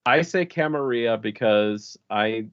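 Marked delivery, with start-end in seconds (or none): 0.4-1.2 Camarilla as cam-a-REE-ah; Spanish-style pronunciation. Shown as a demonstration. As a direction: say Camarilla with no L sound. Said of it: "I see a Spanish word like Camarilla".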